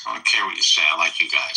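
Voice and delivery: put-on low, heavy Chicago accent; hard Chicago accent. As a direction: lay the Chicago accent on thick. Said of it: "I was trying to do a hard Chicago accent".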